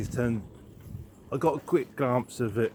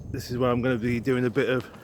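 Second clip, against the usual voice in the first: jolted voice, cycling over bumps; on a bumpy road; 7 dB louder than usual. Real, not acted. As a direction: talk while riding a bumpy road. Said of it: "excuse the bumpy vision".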